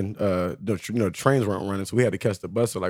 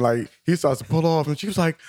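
usual speaking voice

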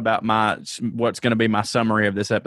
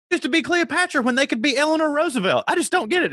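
much higher, whiny mock outrage; mock freak-out. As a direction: parody a squeaky, scandalized freak-out. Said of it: "her whole freak out when she's like".

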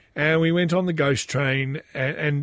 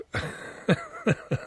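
high laugh; 6 dB quieter than usual. Real, not acted. Laughs at own story.

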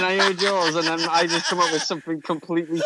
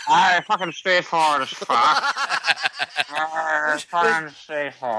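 put-on drunk Irish accent; slower than usual. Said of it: "you sound like a drunk Irishman trying to say it".

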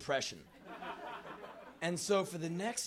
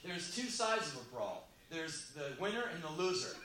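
ordinary talk

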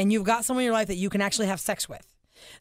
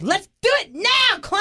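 high, loud, slow playful yell; wife pretending to jump on her sleepy husband; mock shout; 11 semitones higher, 5 dB louder, slower than usual. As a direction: pounce on him with a bossy call. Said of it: "been like".

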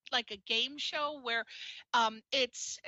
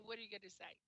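ordinary delivery

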